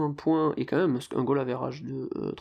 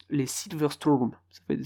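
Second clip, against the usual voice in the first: ordinary talk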